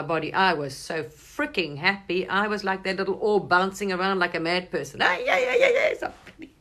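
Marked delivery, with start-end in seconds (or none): 5.0-6.6 much higher, louder, laughing with glee; giddy joyful squeal. Acted. Her soul freed from the body, giddy laughing squeal. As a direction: squeal it like a free bouncing orb.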